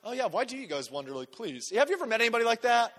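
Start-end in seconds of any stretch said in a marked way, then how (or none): 0.1-1.7 softer, offhand and scoffing; smug dismissive. Retold or imitated. Imagined smug guy to the crowd, mock scoff. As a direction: wave off their amazement smugly. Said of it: "I wouldn't be the guy that's like".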